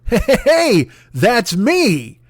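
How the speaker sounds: high, bright hey hey hey, a touch of performing; excited self-quote. Retold or imitated. Replaying his own opening line, high and excited.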